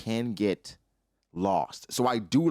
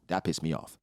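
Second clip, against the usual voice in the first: normal talking voice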